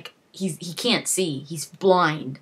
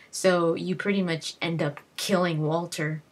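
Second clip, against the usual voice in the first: ordinary talk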